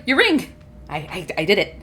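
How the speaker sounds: shy mumbled proposal; timid. Acted. Nervous elf proposing again.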